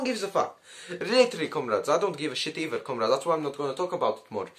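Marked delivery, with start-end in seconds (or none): none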